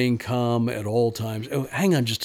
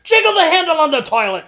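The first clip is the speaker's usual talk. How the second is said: put-on high, loud fussy old man barking advice; shouting old-timer giving plumbing advice; cranky old man; 8 dB louder than usual. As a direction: insist on it at the top of your voice. Prank caller voices another character.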